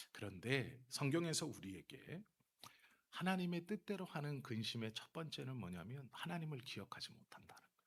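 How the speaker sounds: normal talking voice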